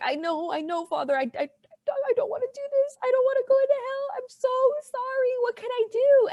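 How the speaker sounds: very high, hammed-up wailing plea; mock crying voice. Baker begs the priest to save her from hell, in exaggerated high-pitched crying. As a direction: wail it way up high, pretending to sob. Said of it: "my crying voice".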